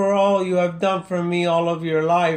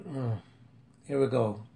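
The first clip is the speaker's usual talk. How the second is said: ordinary talk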